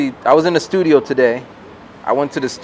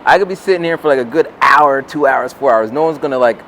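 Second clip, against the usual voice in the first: ordinary talk, nothing marked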